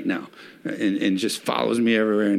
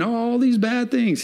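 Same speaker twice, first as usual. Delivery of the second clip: high whiny mock complaint, pitched way up; mock lament; 12 semitones higher than usual. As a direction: voice the prophet's self-pitying cry, much higher. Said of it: "He's shouting them out loud".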